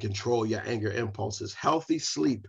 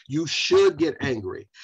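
ordinary talk, nothing marked

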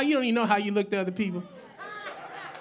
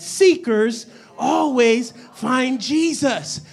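slow, each word set apart; emphatic call-and-response; 5 dB louder, much slower than usual. Shown as a demonstration. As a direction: lead the congregation through it word by word. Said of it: "Everybody say that".

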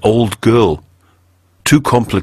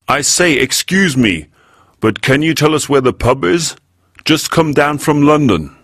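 higher, put-on London accent for a stranger; affected London accent; 7 semitones higher than usual. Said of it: "Hudson was quite good at affecting accents and idioms".

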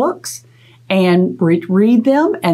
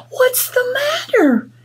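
much higher squeaky mouse voice; high little mouse; slower than usual. Acted. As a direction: ask like a tiny worried mouse. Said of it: "asked his friend Mouse".

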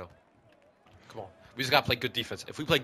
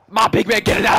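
hype shout; 7 semitones higher, 6 dB louder than usual. Real, not acted. Hyping a teammate.

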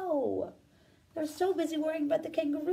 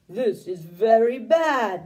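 loud, lower neighed line; neighing horse; 4 semitones lower, 8 dB louder, slower than usual. Acted. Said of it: "neighed the horse".

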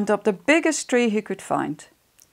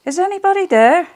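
much higher, louder calling out; lonely cry out; 11 semitones higher, 7 dB louder, faster than usual. Acted. Lonely mouse calls for friends, high loud call. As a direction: call into the empty woods, hoping. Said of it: "he cried out".

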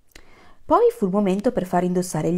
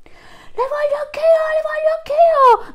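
much higher, loud, slow demanding cry; shouting elephant; 8 dB louder than usual. Acted. Elephant pushes forward demanding horns, high and loud. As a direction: trumpet the demand like a greedy elephant. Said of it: "gridò un elefante".

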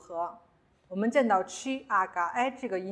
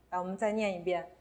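ordinary talk

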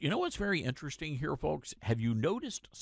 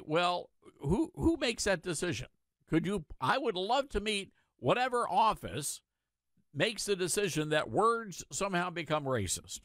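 ordinary delivery

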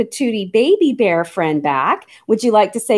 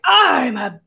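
low growly waking-bear voice; low, playful bear impression; pretend bear; 7 semitones lower than usual. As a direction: groan it out deep like a bear leaving bed. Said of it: "I pretend to be a bear".

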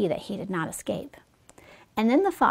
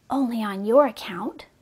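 ordinary delivery, nothing marked

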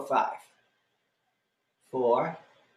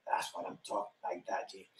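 whispered praise; 8 semitones lower, 14 dB quieter, faster than usual. Real, not acted. Aside praise to one student.

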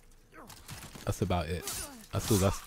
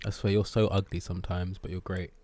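normal talking voice

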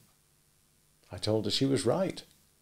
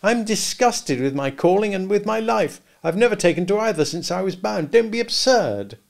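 put-on louder and higher, exasperated young man; impatient exclamation; 9 semitones higher, 6 dB louder than usual. A narrator's reading. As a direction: snap it out impatiently, slowing on the last words. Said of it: "I exclaimed impatiently".

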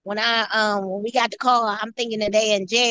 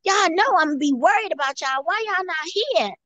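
upset scolding; 6 semitones higher than usual. Retold or imitated. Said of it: "I was so upset with them".

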